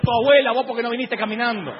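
loud, high mock-furious comeback; angry retort. Preacher hits back at the electrician, loud mock-angry retort. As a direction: blast the insult back at him.

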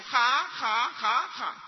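an ironic little laugh, lightly imitated; mock laugh. Boy mocking his father at the table, imitated ironic laugh. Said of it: "irónicamente, hace esto".

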